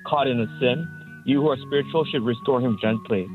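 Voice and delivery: normal talking voice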